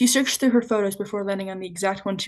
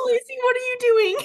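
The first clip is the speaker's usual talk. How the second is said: high-pitched alarm. Real, not acted. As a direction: voice jumps way up in alarm. Calling out to friend squatting.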